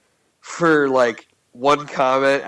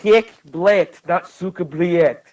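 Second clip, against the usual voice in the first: mock Russian accent; 5 semitones higher, 4 dB louder, slower than usual.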